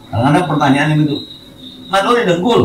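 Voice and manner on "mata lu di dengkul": high, sarcastic scolding jab; mock nagging. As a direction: snipe like a nagging parent. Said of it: "Itu orang lagi ngomel". (omelan orang tua yang menyindir)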